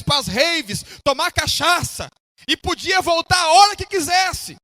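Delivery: fervent pulpit exhortation; impassioned preaching. Real, not acted. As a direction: preach it to the congregation with stretched key words. Preaches at full force.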